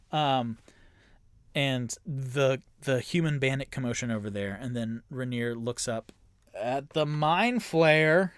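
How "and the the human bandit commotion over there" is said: usual speaking voice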